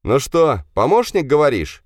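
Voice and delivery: loud, high mocking accusation; angry sarcasm. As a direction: throw his words back at him. Hero accuses the engineer. (герой зло и громко насмехается над инженером)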